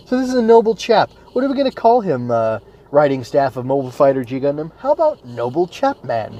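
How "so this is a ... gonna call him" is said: high, hammed-up pondering voice; mock musing. Host lampoons the anime writers' naming, in a high mock musing voice. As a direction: play the writers brainstorming a name, mockingly high.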